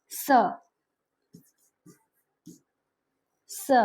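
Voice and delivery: س said as a sharp hissing s with a whistle; whistled s sound. Shown as a demonstration. Teacher shows students the whistling س sound. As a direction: hiss the س like a whistle. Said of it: "سیٹی آتی ہے اس میں".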